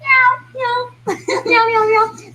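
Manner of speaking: high cat meows with giggles; playful meowing. Acted. Hosts meow to open their couch show. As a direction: meow like a cute kitten.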